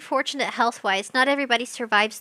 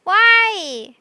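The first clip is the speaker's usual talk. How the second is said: high, loud, put-on wail; dramatic cry; 8 semitones higher, 7 dB louder than usual. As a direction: cry it out like a soap opera. Anguished cry in the stream.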